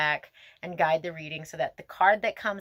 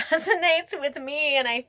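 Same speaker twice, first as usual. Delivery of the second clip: laughing; 8 semitones higher, 5 dB louder than usual. Real, not acted. Laughs at card resonating with her.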